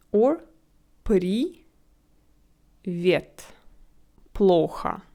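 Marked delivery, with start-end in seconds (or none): 1.0-3.5 привет in two parts, при and вет, pause between; syllable by syllable. Shown as a demonstration. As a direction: join the first three letters of при, pause, then вет. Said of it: "make the first syllable, uh, with three letters".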